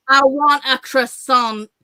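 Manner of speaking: "I want a croissant." slow and loud, every letter crisp; over-enunciated order. Shown as a demonstration. Ordering in France to annoy the staff, every letter enunciated. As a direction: spell out "croissant" with every sound. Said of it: "just really enunciate every single letter".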